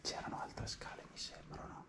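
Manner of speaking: hushed whisper, sneaking. Real, not acted. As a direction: whisper so nobody hears. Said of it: "scusate il volume".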